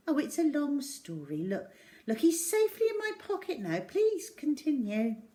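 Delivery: high, breezy and dismissive; breezy casual girl. A narrator's reading. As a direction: wave it off lightly. Zoe brushing it off casually.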